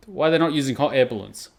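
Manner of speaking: ordinary talk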